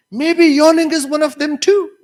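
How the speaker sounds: high, loud comic supposition; mock wondering. Teacher imagining believing the misheard sentence, high mock wonder. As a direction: pitch it up as a far-fetched realization. Acted gullible guess about affairs.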